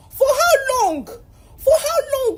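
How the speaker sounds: incredulous laughing question, stretched on the second; disbelieving laugh. Real, not acted. Student mocking the endless exam failures, laughing in disbelief.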